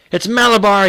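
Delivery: high, loud strange scream. A narrator's reading. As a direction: scream the horse's name possessed. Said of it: "he screamed in a powerful, strange voice".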